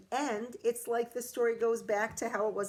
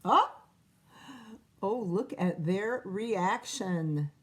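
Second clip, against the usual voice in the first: ordinary delivery, nothing marked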